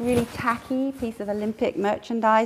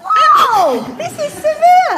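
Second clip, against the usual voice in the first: much higher, louder, fast, incredulous retelling; shocked amazement at a biting child; a lot higher and louder, hammed-up disbelief; 11 dB louder than usual.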